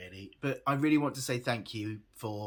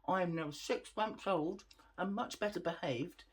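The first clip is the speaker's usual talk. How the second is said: lightly voiced puppy, a bit higher; speaking for puppy; 4 semitones higher, 4 dB quieter than usual. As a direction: pretend to be the puppy talking.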